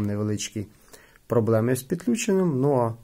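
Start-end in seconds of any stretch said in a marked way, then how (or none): none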